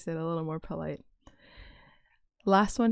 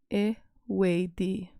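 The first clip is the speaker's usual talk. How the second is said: Eweidi drawn out syllable by syllable; slow, careful; much slower than usual. Shown as a demonstration. Teacher slowing down a tricky word for learners. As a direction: spell Eweidi out slowly with your mouth. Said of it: "That one's a little tricky to say".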